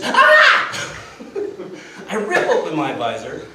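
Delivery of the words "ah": very high, loud shriek; mock scream. Dina screaming as the motorcycle loses control, high shriek. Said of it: "Dina screams".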